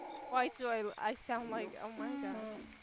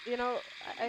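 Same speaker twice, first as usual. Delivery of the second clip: ordinary talk, nothing marked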